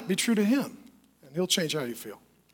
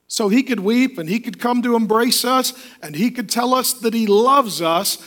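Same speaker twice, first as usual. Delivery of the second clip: usual speaking voice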